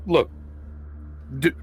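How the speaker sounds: usual speaking voice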